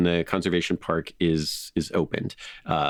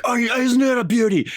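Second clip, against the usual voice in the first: put-on loud, much higher Australian accent, full of glee; 4 dB louder than usual. Host can't resist imitating his infectious energy, Australian accent. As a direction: burst out like an Aussie wildlife enthusiast. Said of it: "you can't not be like".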